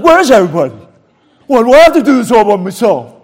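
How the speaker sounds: loud, fast mock huff; self-mocking angry grumble. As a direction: complain loudly like you were left alone. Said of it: "I was in a huff".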